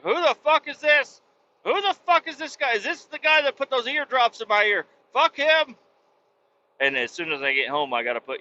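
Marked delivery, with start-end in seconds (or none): 0.0-5.8 voicing the puppy high, sneering and resentful; a cartoon dog grumbling at the stranger; suspicious dog. Puppy reacting when owner comes home, high resentful dog voice. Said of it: "she's gonna be like".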